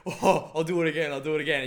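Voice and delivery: high, loud, eager voice; like a kid who just lost and wants another round; gleeful replay. Retold or imitated. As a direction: beg to go again with giddy glee. Gleeful player retrying the game.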